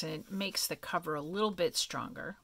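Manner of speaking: ordinary delivery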